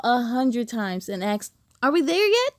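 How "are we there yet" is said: put-on higher whiny kid question; mildly whiny are we there yet; impatient kid. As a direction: nag like a bored child.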